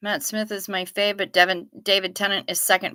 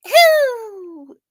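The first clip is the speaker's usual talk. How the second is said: high loud prairie dog squeak; prairie dog call; 13 semitones higher, 9 dB louder than usual. Shown as a demonstration. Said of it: "how to imitate ground squirrels".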